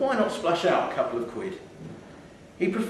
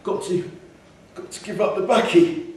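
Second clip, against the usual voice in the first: put-on higher, louder wheezing old man; old smoker wheezing about quitting tobacco; wheezy old smoker; 6 semitones higher, 4 dB louder than usual. A narrator's reading. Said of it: "said the man with a wheeze".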